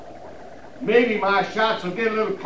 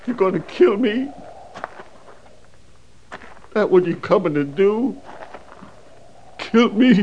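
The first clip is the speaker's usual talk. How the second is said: mocking disbelief. Sniper mocks the captain's threat.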